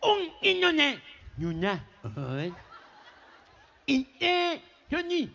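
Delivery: high, garbled nasal order; gangoso, nasal twang. Acted. Boy orders from the butcher, high gangoso voice. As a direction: push unintelligible words through the nose, high. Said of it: "nene gangoso".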